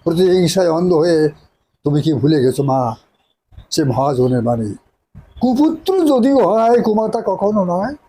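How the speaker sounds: impassioned theatrical plea to a mother; hammy stage actor. Acted. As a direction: recite with stage drama, pleading. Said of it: "আরেকটা বলেন".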